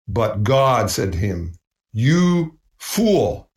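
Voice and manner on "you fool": stern God. Acted. God rebukes the rich man.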